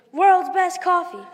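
slow, high, very loud excited yell; excited scream. Acted. Buddy the Elf screaming at the diner, excited shout. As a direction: shout it out high, drawing out each word. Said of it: "gets so excited and then screams".

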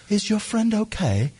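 high, slow fake British concern; bad British accent. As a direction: imitate a poser's phoney British accent. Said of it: "talking in the worst British accent".

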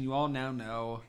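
ordinary delivery

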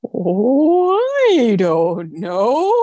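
high, slow, spooky ghost wail. Acted. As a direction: moan it out slowly like a ghost. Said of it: "Is that a good ghost impression?".

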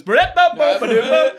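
loud, high laughing ba-da-ba gibberish; loud mock gibberish of a mumbled yell. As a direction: mimic unintelligible shouting as nonsense syllables. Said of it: "all I heard was".

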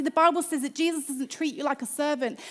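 normal talking voice

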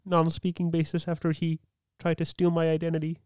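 Kermit. Acted. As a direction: play the cheery frog host greeting listeners. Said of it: "Do your Kermit".

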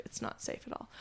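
breathy whisper under the breath; whispered aside. Real, not acted. Whispered aside about the neighborhood.

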